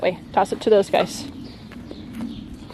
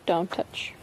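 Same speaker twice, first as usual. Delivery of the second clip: dropped right down, much softer; softer repeat; 5 semitones lower, 10 dB quieter than usual. Real, not acted. Mom repeats to her son not to touch, much softer. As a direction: say it again, quietly and firmly.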